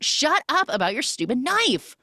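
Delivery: high-pitched fed-up mock yell; mock exasperated retort. [name] answers Simon's bitching about his knife, mock exasperation. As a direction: imitate someone sick of hearing it. Acted retort to nagging complaint.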